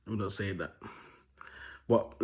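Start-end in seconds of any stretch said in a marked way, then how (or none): 0.1-0.7 hushed aside to yourself; real quiet backtracking, much softer; muttered self-censoring. Real, not acted. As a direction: drop the volume right down, holding back. Stops himself from saying something.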